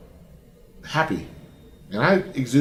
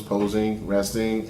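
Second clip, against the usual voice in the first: ordinary talk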